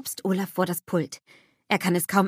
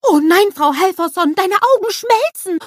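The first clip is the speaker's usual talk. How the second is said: high, loud excited snowman cry; a worried little snowman calling out, much higher and louder; startled cartoon snowman exclaiming; Olaf, alarmed; 12 semitones higher, 10 dB louder than usual. A narrator's reading. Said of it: "ruft er aufgeregt".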